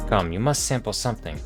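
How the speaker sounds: usual speaking voice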